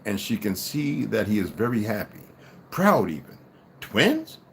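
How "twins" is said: high-pitched, bewildered questions; puzzled tearful wife. A narrator's reading. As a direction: ask it high, without a clue. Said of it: "she asks while she blows her nose".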